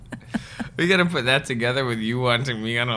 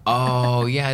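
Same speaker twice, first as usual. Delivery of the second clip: ordinary delivery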